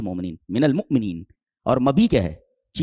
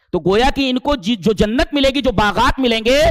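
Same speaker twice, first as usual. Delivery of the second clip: excited preaching; 11 semitones higher, 5 dB louder, slower than usual. Acted. Excited sermon rise.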